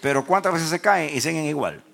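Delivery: high, loud pulpit voice; forceful preaching. Real, not acted. Preacher challenging the congregation about people falling, forceful preaching.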